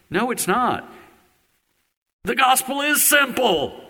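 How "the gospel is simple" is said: fervent proclamation. Real, not acted. Preacher declaring the gospel to unsaved hearers, fervent high proclamation. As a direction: proclaim it like the heart of the sermon.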